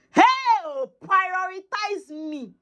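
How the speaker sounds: high, drawn-out exclamation; slow, high-pitched marvelling; amazed emphasis. Real, not acted. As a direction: stretch it out high in wonder. Marvels that God favours her.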